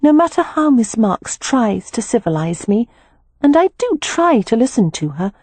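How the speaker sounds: a hint of a young girl, thoughtful and sure; lightly voiced Beatrix, earnest.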